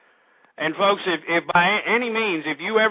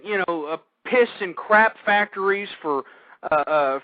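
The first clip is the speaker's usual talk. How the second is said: normal talking voice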